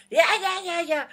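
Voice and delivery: high, dismissive mock chatter; imitated outcry, voice well above normal; mock protest. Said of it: "scream and shout".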